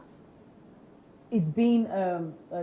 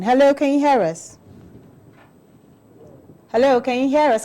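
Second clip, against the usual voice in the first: loud, raised-pitch check on the line; calling out; 5 semitones higher, 7 dB louder than usual. Real, not acted. Radio host to a caller on the line, calling out. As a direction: call out to a caller you can't hear.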